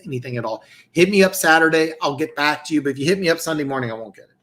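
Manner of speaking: usual speaking voice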